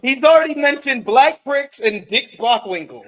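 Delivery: high, loud, amused disbelief; laughing. Real, not acted. Host repeats guest's outrageous words to audience, laughing. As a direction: exclaim through laughter.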